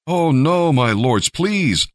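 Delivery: high, pleading cry of protest; desperate begging. A narrator's reading. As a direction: beg as if the ground is giving way. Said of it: "Lot begged".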